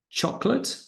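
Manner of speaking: chocolate, high on CHOC; stress on first syllable. Shown as a demonstration. Teacher showing word stress. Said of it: "let's check stressed syllable".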